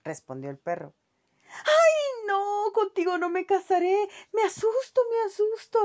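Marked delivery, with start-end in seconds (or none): 1.6-5.8 voz de ratita aguda y fuerte, chillando; chillido asustado. A narrator's reading. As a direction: grita asustada como ratón. La ratita rechaza al perro. (ratita al perro, chillando de miedo)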